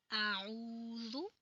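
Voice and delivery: drawn-out Quranic recitation; slow tajweed reading. Shown as a demonstration. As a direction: stretch each syllable slowly. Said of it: "practice करनी है कि आऊज़ु बिल्लाह और बिस्मिल्लाह हम कैसे पढ़ेंगे".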